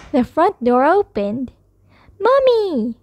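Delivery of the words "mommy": high, drawn-out call; crying out. A narrator's reading. Child calling Mommy at the front door, crying out. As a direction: call out high, letting the pitch fall. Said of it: "I cried".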